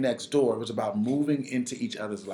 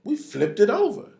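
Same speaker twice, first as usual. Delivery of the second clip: high-pitched punchline delivery; mock-proud, high announcement of the deed; gleeful reveal; 9 semitones higher, 4 dB louder than usual. Neighbours' punchline, retold.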